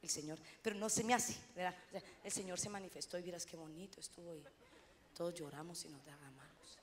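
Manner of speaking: forcing the joy down to a murmur; wife tells husband her news in a muted hush; subdued telling. Retold or imitated. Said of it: "Entonces le digo".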